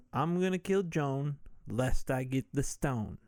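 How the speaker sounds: ordinary delivery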